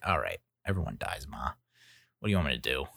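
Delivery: imitating a resigned son; mock resignation. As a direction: give up arguing with mom. Resigned reply to his mother.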